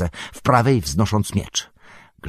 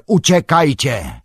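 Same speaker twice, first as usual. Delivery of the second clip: high, loud, drawn-out yell; Gandalf's shout; 7 semitones higher, 5 dB louder, slower than usual. A narrator's reading. Wizard orders companions to flee, long urgent shout. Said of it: "krzyknął Gandalf, odzyskując energię".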